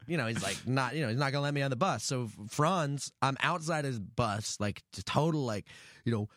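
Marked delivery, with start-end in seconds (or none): none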